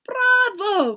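high, loud cheer; cried out. A narrator's reading. Witches applauding their leader, cried out. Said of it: "cried the witches".

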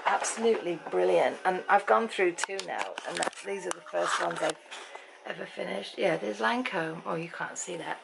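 ordinary delivery

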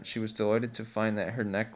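plain reading voice

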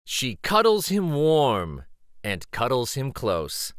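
plain reading voice

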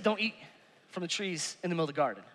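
mild quoted command from the husband; quiet low retold rule; Adam's rule. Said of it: "He said".

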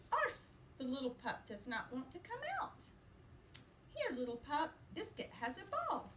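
lively, sing-song picture-book reading; reading to children. A narrator's reading. Teacher reading a puppy book to her class, playful and lively. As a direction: read it brightly to little listeners.

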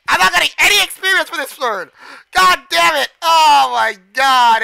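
loud, high outburst of frustration; frustrated yelling. Real, not acted. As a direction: yell in exasperation, stretching out the end. Losing experience in game.

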